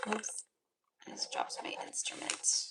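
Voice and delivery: hushed whisper aside; an embarrassed whispered aside. Real, not acted. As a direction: whisper it to yourself after the slip. Drops instrument during live reading.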